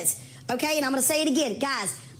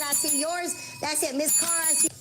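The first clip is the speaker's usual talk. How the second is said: ordinary delivery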